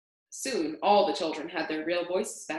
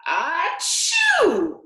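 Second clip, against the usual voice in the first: huge sneeze; 11 semitones higher, 8 dB louder than usual. Acted.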